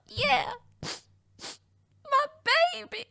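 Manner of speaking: put-on very high baby-talk coo, fading away; cooing back. Owner agreeing with staff about her cat, high coo.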